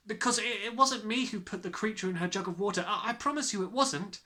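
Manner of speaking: put-on a child begging to be believed; anxious little girl.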